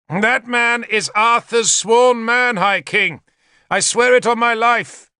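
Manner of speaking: high, drawn-out denunciation; urgent public accusation. A narrator's reading. Traitor exposing Arthur's man to the king, high declamation. Traitor denounces narrator before the hall.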